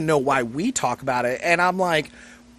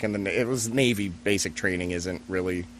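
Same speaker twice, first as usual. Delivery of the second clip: ordinary talk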